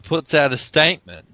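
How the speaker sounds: ordinary talk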